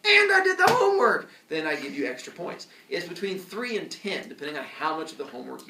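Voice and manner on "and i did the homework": put-on high, loud bragging kid voice; smug kid. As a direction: brag like a know-it-all kid. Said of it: "If you're like".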